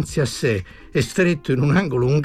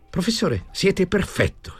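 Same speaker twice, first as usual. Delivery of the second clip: admiring compliment; 6 semitones higher than usual. A narrator's reading. Tommasini compliments the professor, admiring. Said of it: "disse Tommasini".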